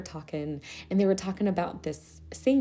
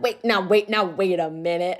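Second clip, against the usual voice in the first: mock offended; 4 dB louder than usual.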